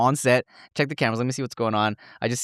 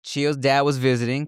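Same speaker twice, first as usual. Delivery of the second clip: usual speaking voice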